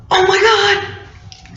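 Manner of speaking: high, slow, drawn-out cry of terror; retold terrified scream. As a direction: cry out as if seeing the ghost again. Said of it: "screaming at this point".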